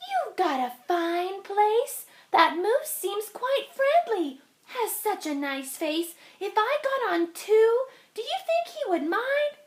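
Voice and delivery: a dainty tree spider speaking up; tiny spider voice. Acted. Spider keeps asking.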